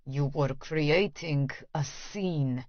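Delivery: low, slow through gritted teeth. Acted. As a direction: push it out through clenched teeth. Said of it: "he says through gritted teeth".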